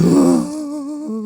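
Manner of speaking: high, drawn-out swooning gasp; mock fan gasp. Friend imitating fans in tears over a player, mock high gasp.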